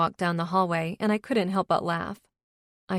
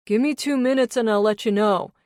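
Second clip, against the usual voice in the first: louder call, voice turned away; called over shoulder; 4 semitones higher, 7 dB louder than usual. Acted. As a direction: call back while walking off. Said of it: "he called over his shoulder".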